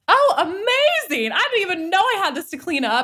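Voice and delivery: overjoyed at finding a mess; hammed-up cheerful surprise; mock delight. Mock delighted reaction to trigger.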